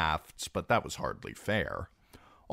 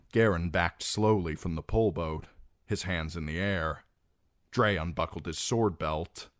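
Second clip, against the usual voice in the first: usual speaking voice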